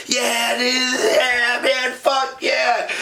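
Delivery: high, excited cheering shouts; retold cheering. Friends cheering a lucky win at the table, shouting. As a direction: whoop it up like your team just won. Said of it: "we're sitting there cheering".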